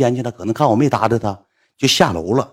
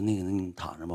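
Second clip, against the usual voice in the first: much softer, hushed apology; very quiet; 7 semitones lower, 16 dB quieter than usual. Retold or imitated. Apologising to the woman in the dark, very quiet. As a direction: say it under your breath so nobody wakes. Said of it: "我还老小声了".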